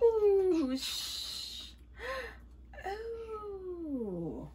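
high, drawn-out oohs after a gasp; gasp, then coo in amazement; amazed gasping "ooh". Real, not acted. Admiring the wig under a cap, amazed oohs.

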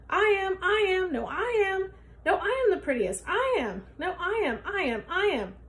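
high, loud squabbling voices; squabbling cats. Acted. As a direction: bicker like jealous cats. Cats quarrel loudly.

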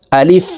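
আলিফ clipped short with a light rise; quick short alif. Shown as a demonstration. Teacher repeating the letter for viewers, short and quick. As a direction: say আলিফ crisply, no stretch. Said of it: "দ্রুতভাবে উচ্চারণ করতে হবে".

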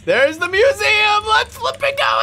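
high, loud, thrilled yell; excited shout. Real, not acted. Gamer reaching the museum, shouting with excitement. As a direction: shout it out like you finally made it.

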